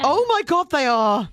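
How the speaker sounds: high-pitched, astonished outburst; shocked realisation. Real, not acted. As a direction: let the discovery hit you, pitch jumping. Stunned by anatomy revelation.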